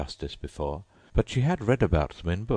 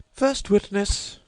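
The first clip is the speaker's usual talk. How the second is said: much higher, slow announcing call; rabbit calling out; 4 dB louder, slower than usual. A narrator's reading. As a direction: call the witness in like a herald. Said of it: "called out".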